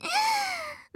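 high teasing giggle; mocking giggle. Sister laughing at brother's small hands, high giggle. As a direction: giggle at his clumsy hands.

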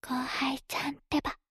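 breathy whisper into the ear. Acted. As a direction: whisper it playfully, close up. Same phrase later voiced.

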